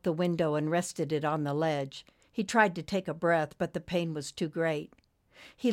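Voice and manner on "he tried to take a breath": ordinary delivery, nothing marked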